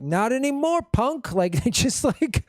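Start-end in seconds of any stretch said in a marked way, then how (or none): 0.0-1.3 higher-pitched bully jeer; mock tough playground taunt; schoolyard bully. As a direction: claim it like a bully. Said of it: "just like a schoolyard bully".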